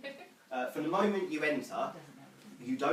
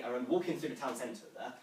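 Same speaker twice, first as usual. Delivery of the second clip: ordinary delivery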